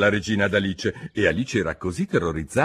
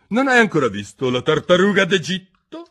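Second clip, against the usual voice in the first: high, loud royal question; imperious queen; 10 semitones higher, 6 dB louder than usual. A narrator's reading. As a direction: demand it as an overbearing queen. Queen voiced questioning Alice.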